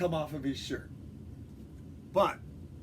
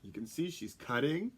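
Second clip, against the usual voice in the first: plain reading voice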